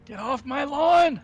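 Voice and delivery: put-on high, loud, slow old man shout; old prospector. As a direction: yell it like a grumpy grandpa. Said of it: "old person word".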